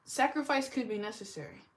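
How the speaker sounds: normal talking voice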